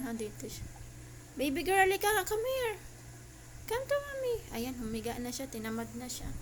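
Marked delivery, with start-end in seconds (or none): 1.3-4.4 high-pitched pet-calling voice; coaxing a pet. Real, not acted. Mommy calling baby girl to come, high coaxing. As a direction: coo a high invitation to come over.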